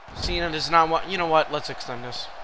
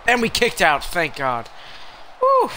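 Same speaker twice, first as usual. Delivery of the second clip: excited, raised-voice play-by-play; relieved outburst; 5 dB louder than usual. Real, not acted. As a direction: call out the narrow escape. Escapes a pin in game.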